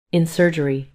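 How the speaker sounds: plain reading voice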